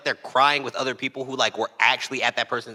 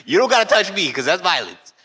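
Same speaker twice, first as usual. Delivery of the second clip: high, loud, laughing protest; mock loud denial; 7 semitones higher, 7 dB louder than usual. Denying being one of them, loud and high. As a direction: yell it in mock offence.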